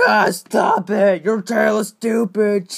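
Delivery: loud angry shouted taunt; shouting. Acted. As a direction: yell the insult out.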